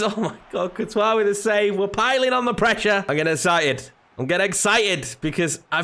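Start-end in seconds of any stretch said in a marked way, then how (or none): none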